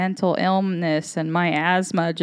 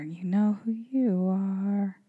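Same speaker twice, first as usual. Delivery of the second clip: softer, slower hushed repeat of the line; soft slow echo; 2 semitones higher, 5 dB quieter, slower than usual. Retold or imitated. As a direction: breathe the line again gently, savouring it. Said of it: "what else is there".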